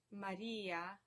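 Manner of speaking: Maria with the i drawn out; penultimate stress. Shown as a demonstration. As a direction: lean on "ri" in Maria. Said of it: "stress on the second to last syllable".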